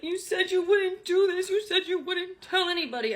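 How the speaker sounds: high, loud imitation of a crying ex; mock tearful. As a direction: whine it accusingly, as if in tears. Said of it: "in tears, he's like".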